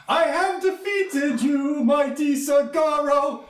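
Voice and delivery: put-on high, slow, proud child voice; little girl's triumph. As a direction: declare victory like a tiny knight. Little girl plays conqueror.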